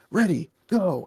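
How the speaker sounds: high-pitched transformation call; mock hero call. Voices hero's transformation call.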